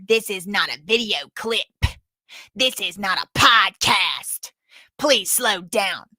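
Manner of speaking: slow, deliberate stern judge scolding; judge impression. Judge warning a fast-talking witness to slow down, slow and stern. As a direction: spell it out slowly like a fed-up judge. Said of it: "that's my judge impression".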